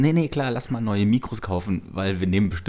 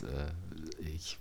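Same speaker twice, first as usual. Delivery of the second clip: whispering; 5 semitones lower, 16 dB quieter than usual. Acted. Nervous ex calls on Valentine's.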